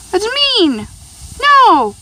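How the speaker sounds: high, loud telling-off; the No shouted loudest; scolding shout. Real, not acted. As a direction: yell to stop the pets. Scolding pets fighting.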